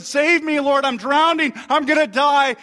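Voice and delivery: high, urgent plea for rescue; crying out. Retold or imitated. Peter drowning, crying out to Jesus in panic. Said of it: "He cried out to Jesus".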